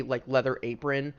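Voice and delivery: ordinary delivery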